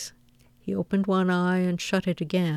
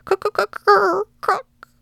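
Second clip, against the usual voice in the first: very high squirrel chatter, bursting louder; scolding shout; 14 semitones higher, 7 dB louder than usual. A narrator's reading. Squirrel scolds anyone who asks a riddle, high squawked shout. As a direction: squawk it like a cross little animal. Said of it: "and scold and shout".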